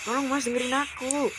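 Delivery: high-pitched plea; pleading wife. Acted. Wife begging husband to listen, pleading. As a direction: beg him to listen.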